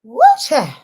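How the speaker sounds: high, loud woo; loud exclamation. Real, not acted. Vlogger exclaims to viewers, loud and high. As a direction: let out a big woo.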